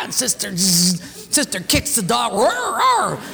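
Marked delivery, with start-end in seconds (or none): 2.3-3.2 mock roar. Sister kicks the dog.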